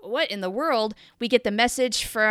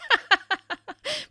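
burst of laughter; 11 semitones higher than usual. Real, not acted. Laughing at the Lieutenant J gag.

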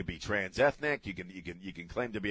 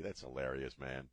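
usual speaking voice

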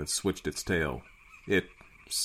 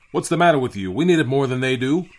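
loud, higher-pitched rebuke; raised voice, justifying himself; indignant captain; 7 semitones higher, 9 dB louder than usual. A narrator's reading. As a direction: challenge the mate forcefully.

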